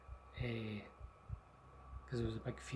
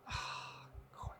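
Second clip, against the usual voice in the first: weary sigh; 13 dB quieter than usual. Retold or imitated. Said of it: "he was like".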